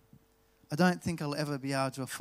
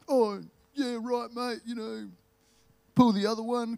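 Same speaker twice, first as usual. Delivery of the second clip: higher broad Australian bloke, disbelieving; ocker Aussie voice; 6 semitones higher, 4 dB louder than usual. Retold or imitated. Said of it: "I don't know why I'm using that voice".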